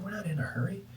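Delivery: high hushed whisper; hushed ants' reply. Retold or imitated. The ants reply to the man in a hushed whisper. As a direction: answer in a small breathy whisper.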